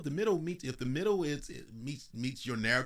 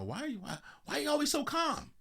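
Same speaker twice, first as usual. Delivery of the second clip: much higher exasperated wife voice; the wife pleading, pitched way up; imitating his wife. Ex-wife asking why he stays so calm, high mock-exasperated. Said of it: "she said".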